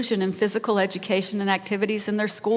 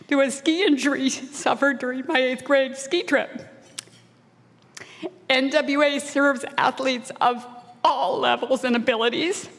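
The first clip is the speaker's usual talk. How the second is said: real tearful voice, higher, catching with small laughs; choked up, near tears; 5 semitones higher than usual. Real, not acted. Speaker recalling being picked last at school, choked up and tearful. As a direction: fight back tears and push on through the speech. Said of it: "I'm gonna cry again".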